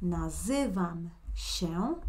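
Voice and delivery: nazywam się said slowly, every syllable clear; slow teaching demo. Shown as a demonstration. Teacher showing the student the yo form, slowly. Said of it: "Mira siempre mi flecha".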